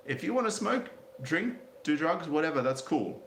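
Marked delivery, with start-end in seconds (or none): none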